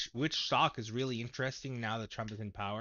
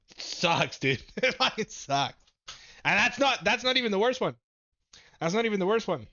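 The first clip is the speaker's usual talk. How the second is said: loud, high-pitched exasperated outburst; exasperated venting; 9 semitones higher, 7 dB louder than usual. Real, not acted. Streamer venting to viewers about losses, exasperated. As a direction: vent it loudly, pitched well up.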